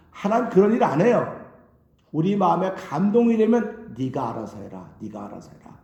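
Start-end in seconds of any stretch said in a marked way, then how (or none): 4.0-5.8 much lower and softer, a calm God letting go; God's voice. Acted. Preacher voicing God to the believer, low and hushed. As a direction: speak as God, low and hushed, leaving the choice to you.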